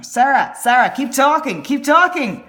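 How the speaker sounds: high, loud mock plea; urgent pleading. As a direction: call out urgently to keep her going. Urgently begging dinner companion to talk.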